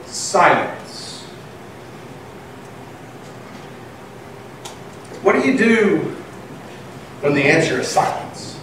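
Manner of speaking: soft, near-whispered telling; hushed preaching. Acted. Preacher hushes the congregation about Jesus' silence, softly. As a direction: drop the voice to draw them in.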